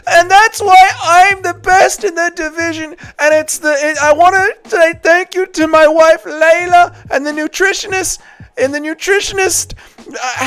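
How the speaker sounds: high put-on post-fight speech voice; Ian Garry impression. Chat asks for Ian Garry's post-fight speech, high put-on voice. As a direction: do a cringey winner's speech, pitched high. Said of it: "Do an impression of Ian Garry".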